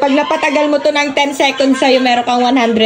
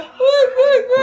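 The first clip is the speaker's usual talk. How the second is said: panicked pleading; much slower than usual. Real, not acted. Pleading as massager hits.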